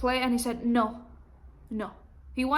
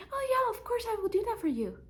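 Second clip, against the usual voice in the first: high, quick mock-eager oh yeah; mock people-pleaser; 10 semitones higher, 4 dB quieter than usual. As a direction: parody her own overeager yes. Mocking her own people-pleasing.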